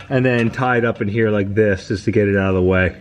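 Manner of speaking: ordinary delivery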